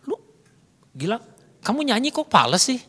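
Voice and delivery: blunt reproach, pitch jumping up on the complaint; blunt displeasure. Shown as a demonstration. Speaker to Gilang, bluntly faulting his off-key singing, displeased. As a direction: criticise directly without any sugarcoat. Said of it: "muka saya udah nggak enak".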